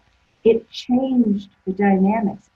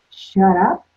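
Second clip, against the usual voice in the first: louder, questioning repeat with rising end; incredulous echo; 6 dB louder than usual. Retold or imitated. Client questioning her therapist's advice, mock incredulous. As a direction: throw it back as an offended question. Client echoes it, taken aback.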